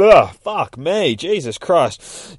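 loud, high groan of frustration; exasperated. Real, not acted. Reader botching a line yet again, real exasperation. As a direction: curse your own fumble.

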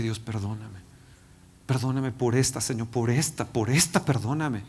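soft hesitant prayer for forgiveness; hushed stammering plea for pardon; quiet confession. Retold or imitated. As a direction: murmur it, ashamed, searching for words.

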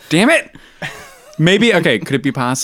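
loud, high, still laughing; after laughing hard. Real, not acted. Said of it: "So loud, so much louder all of a sudden".

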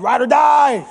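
loud shouted oath of loyalty; bold vow. Acted. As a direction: belt it out like a battle cry. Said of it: "All the disciples are like".

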